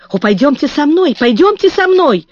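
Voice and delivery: high, rising urgent plea; panicked pleading. Acted. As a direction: beg him in rising panic. Panicked mother begs officer.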